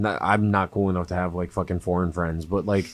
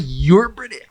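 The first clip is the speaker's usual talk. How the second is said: excited realisation; 9 semitones higher, 5 dB louder than usual. Retold or imitated. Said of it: "I was like".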